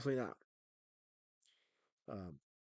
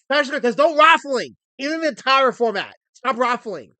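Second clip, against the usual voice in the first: loud, high-pitched reprimand; scolding shout; 7 semitones higher, 11 dB louder than usual. Real, not acted. Host ordering a chatter to stop raffling, scolding shout. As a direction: yell at them to knock it off.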